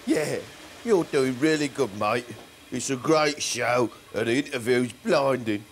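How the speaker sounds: low, soft, falsely kind; mock sweet. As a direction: lull him with fake praise. Baboon suddenly turns soothing, friendly.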